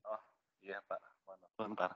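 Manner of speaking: faint voice far from the mic; almost inaudible, distant speaker; barely audible. Real, not acted. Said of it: "suaranya terlalu kecil banget".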